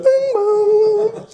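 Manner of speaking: sung high store chime; tannoy chime voice. Shown as a demonstration. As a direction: sing the announcement chime high. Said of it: "he was going".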